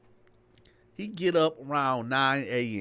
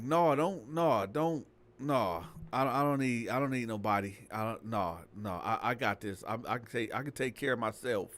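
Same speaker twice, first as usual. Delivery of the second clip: quiet refusal; 6 semitones lower, 5 dB quieter than usual. Retold or imitated. Girl refusing police help.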